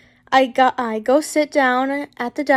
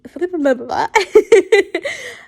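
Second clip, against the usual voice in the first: giggle; 7 semitones higher, 6 dB louder than usual. Real, not acted. Laughs mid letter reading.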